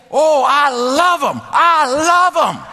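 loud, slow, high cry of love; shouted joy. Retold or imitated. Guard shouting his new love for Asians. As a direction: belt it out like a man overjoyed. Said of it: "just like that".

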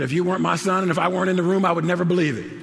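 mama stunned by the found glasses; imitating amazed mama. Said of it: "She said".

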